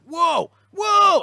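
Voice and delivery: high, loud amazed shout; amazed whoa. Retold or imitated. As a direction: blown away, shout it out. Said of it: "And you're just like".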